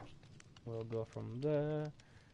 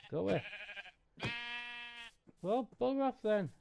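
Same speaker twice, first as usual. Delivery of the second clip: shooing; 12 semitones higher, 3 dB louder than usual. Real, not acted. Shooing a mob in game.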